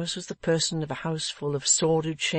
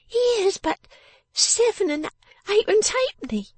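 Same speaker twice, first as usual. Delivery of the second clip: very high, loud little voice; indignant exclamation; 5 dB louder than usual. A narrator's reading. Miss Wren counts her father's money, exclaiming in high indignation. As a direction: exclaim it in a piercingly high scold. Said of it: "exclaimed Miss Wren".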